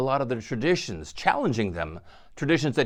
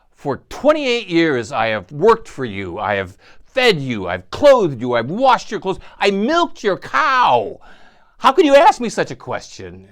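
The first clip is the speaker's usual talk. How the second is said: indignant protest; 4 semitones higher, 9 dB louder than usual. Retold or imitated. Wife protests her devotion.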